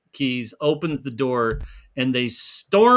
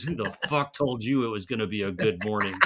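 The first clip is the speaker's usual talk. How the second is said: low, quick growled comeback; feral snarling; 5 semitones lower, 5 dB quieter, faster than usual. Retold or imitated. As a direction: growl it under your breath. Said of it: "just growls it out and says".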